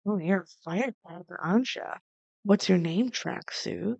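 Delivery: soft, teasing come-on; hushed flirting. Acted. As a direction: drop the volume and flirt smoothly.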